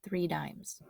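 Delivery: ordinary delivery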